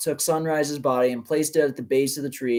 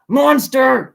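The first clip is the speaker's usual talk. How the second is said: high, loud furious yell; 11 semitones higher, 10 dB louder than usual. Acted. Troll father screaming at the Bergen, furious yell. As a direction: scream the insult up at the villain. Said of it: "he yelled".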